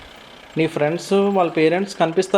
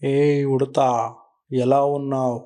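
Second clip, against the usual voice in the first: lower, slow big-animal voice; a friendly gorilla greeting a small visitor; slow, low gorilla welcoming the squirrel; gorilla uncle; 5 semitones lower, slower than usual. Acted.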